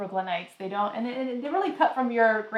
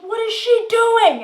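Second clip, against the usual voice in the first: much higher, louder, slow disbelief; incredulous; 13 semitones higher, 10 dB louder, slower than usual. Retold or imitated. She reacts to the mail guy's insult, higher, louder and slow. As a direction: stretch it out in shocked disbelief. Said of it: "and I was like".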